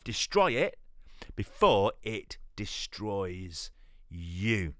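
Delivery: slow and deliberate, pausing before the last word; said slowly. Shown as a demonstration. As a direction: drag it out so every word lands. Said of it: "and I'll say this slowly".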